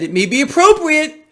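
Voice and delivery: high, loud mocking quote; hammed-up official's pronouncement; mock quoting an official. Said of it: "So she said, the vice chair".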